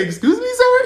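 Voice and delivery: very high, loud squeal; affronted shock. Retold or imitated. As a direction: squeal it in mock offence. Shocked at being volunteered.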